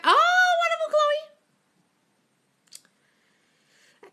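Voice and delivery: very high, loud oh of delight; delighted squeal. Real, not acted. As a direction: gush over a follower's good news. Delighted reaction to viewer's plan.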